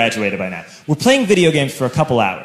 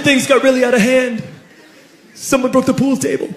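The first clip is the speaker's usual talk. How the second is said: put-on higher, lightly whiny teen; upset classmate; 7 semitones higher than usual. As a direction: complain like a kid in trouble. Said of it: "And he said".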